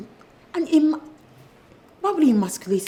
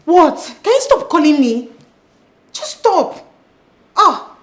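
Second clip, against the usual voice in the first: higher, louder fed-up outburst; exasperated shout; 9 semitones higher, 12 dB louder, slower than usual. Acted. Sister fed up with persistent caller, exasperated shout. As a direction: snap at the caller to stop.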